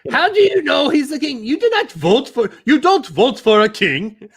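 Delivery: high screechy mock English accent; Monty Python English accent. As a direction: argue indignantly in a silly, screechy English accent. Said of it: "Monty Python English accent, you kinda have to screech".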